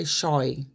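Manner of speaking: "shoy" for shy, with an OY vowel; regional vowel. Shown as a demonstration. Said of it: "they use strange phrases".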